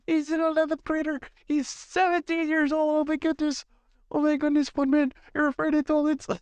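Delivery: high-pitched teasing classmate voice; mocking accuser. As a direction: squeal it like a taunting twelve-year-old. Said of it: "You're gonna say".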